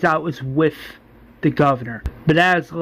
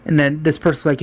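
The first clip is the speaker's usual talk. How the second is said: plain reading voice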